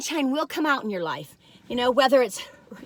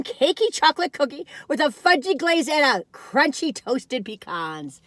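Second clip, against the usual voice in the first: usual speaking voice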